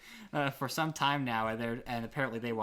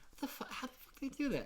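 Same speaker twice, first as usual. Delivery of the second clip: baffled; 12 semitones higher, 5 dB quieter, faster than usual. Retold or imitated. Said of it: "be like".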